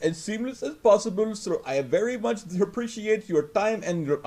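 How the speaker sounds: high put-on foreign voice; mock scripted agent. Friend joins mocking the agent's script, high put-on voice. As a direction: parrot the overly polite script. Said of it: "they always have these scripted shit like".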